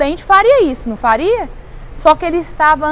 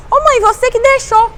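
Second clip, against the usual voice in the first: put-on high-pitched child talking back to mum; child protesting; 8 semitones higher, 3 dB louder than usual. Child reminds mother she allowed it, high protesting voice.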